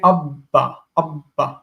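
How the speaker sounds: abba split as "ab-ba", the b held with a gap; doubled consonant. Shown as a demonstration. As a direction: hold the double b in abba, then release "ba". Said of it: "that's the doubling sound".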